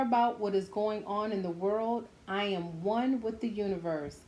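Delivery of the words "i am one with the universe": ordinary delivery, nothing marked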